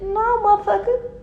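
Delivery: sudden high-pitched no way; a sharp high squeal of refusal; high-pitched indignant refusal. Acted. Hen refusing to share bread.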